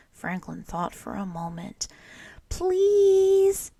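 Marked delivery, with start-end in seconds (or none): 2.5-3.7 high, loud pleading please; high drawn-out plea; begging. A narrator's reading. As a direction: beg hard, stretching the word. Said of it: "begged Fox".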